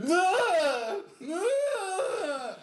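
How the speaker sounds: very high piercing mock screams; imitated night terror screams. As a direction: mimic her screaming from the bedroom. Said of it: "out of her bedroom".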